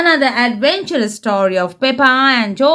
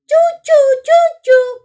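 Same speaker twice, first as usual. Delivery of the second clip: put-on much higher, louder toddler choo-choo; George crying choo-choo; 14 semitones higher, 5 dB louder than usual. George loving the magic train, cries high and loud choo-choo. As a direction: belt it out like a thrilled little boy. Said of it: "cries George".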